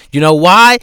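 ordinary delivery

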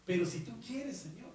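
soft, hesitant half-hearted plea; a mild take on a doubting believer; mimicking a doubtful prayer. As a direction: imitate someone who prays without faith. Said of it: "clásico de la oración que muchos dicen".

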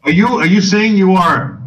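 ordinary talk

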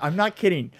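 ordinary talk

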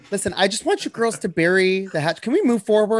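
a hint of a peacemaking housewife; lightly voiced TV housewife playing host; reality housewife. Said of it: "sounds like a housewife episode".

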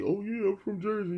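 high, drawn-up mock boast; mocking imitation. Imitating a rapper claiming Jersey, high mocking voice. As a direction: mimic the hometown claim in a high voice.

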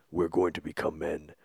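low, solemn Viking vow; Ethan Hawke impression. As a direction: intone it like a grim warrior. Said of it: "that's how, like, Ethan Hawke does it".

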